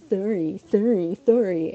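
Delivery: slow, lisped, insincere sorries; exaggerated mush-mouthed sorry; mocking clerk's apology. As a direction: mimic a half-hearted apology with contempt. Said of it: "she's just like".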